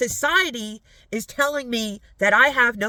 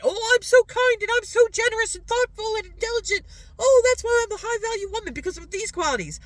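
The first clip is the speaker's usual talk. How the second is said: sarcastic mock voice; 10 semitones higher than usual. Mocking imagined self-praise.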